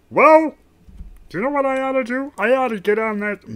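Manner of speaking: high, loud cartoon alien; cartoon villain musing loudly; Marvin the Martian. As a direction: pipe up high and forceful, in character. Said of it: "Marvin the Martian".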